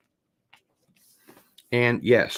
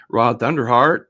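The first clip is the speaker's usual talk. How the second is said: loud, higher announcer-like call; calling out a name; 4 semitones higher, 10 dB louder than usual. Pretend. Streamer to viewer, calling out the name loudly. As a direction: belt out the name.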